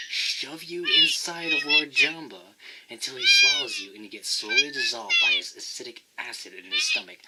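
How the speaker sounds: building to a very high, loud screech; mock furious rant. Threatening Navi with a fly swatter, escalating screech.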